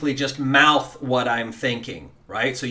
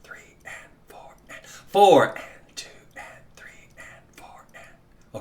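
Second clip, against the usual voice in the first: whispered count; 4 semitones higher than usual. Shown as a demonstration. Teacher whispering the count along with the class. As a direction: whisper it, first beat stronger. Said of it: "Let's do this together".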